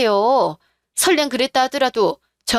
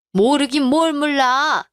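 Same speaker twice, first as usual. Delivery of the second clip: scornful retort; 3 semitones higher, slower than usual. Acted. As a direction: drag each word out with contempt. Mother-in-law retorts scornfully.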